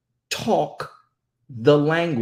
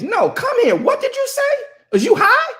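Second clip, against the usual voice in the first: much higher, louder scolding mom; shrill mom; 6 dB louder than usual. Retold or imitated. Mother confronting her son, high and loud. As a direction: pitch up like a suspicious mother. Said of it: "I make my sons".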